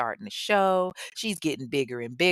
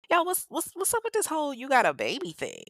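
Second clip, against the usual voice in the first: curious people; 9 semitones higher, faster than usual. Retold or imitated. People questioning him, voiced.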